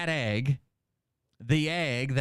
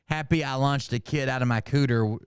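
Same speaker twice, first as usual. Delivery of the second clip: ordinary delivery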